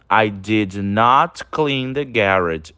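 slow, careful, leaning on "not"; slow model reading. Shown as a demonstration. Teacher reading the negative sentence to students, slowly. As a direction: read it slowly and lean on "not". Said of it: "Então".

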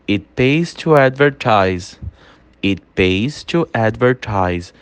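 slow, spaced drill phrase; slow teacher model of "It pays to advertise". Shown as a demonstration. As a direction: lay each word out slowly to be repeated. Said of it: "Tudo que eu falar em inglês, você vai ler e você vai repetir".

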